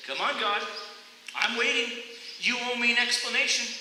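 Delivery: high, loud, impatient insistence; impatient demand. Retold or imitated. Runner loudly demanding God explain himself. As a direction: demand an answer right now. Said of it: "And I said".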